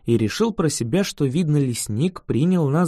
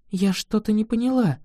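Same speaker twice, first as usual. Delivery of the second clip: put-on higher-pitched girl's challenge; defiant; 5 semitones higher than usual. A narrator's reading. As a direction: challenge them, voice lifted to the end. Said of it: "произнесла Ин с вызовом".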